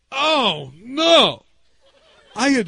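loud, high "oh, no"; grandfather's dismay. Retold or imitated. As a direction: imitate a grandfather's stricken cry of regret. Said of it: "his oh, no was different".

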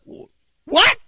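high, loud, stammered disbelief; comic squeal of surprise; stunned. Retold or imitated. Pastor reacts to sudden baptism request, exaggerated shock.

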